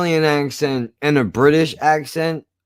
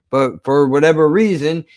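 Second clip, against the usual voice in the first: usual speaking voice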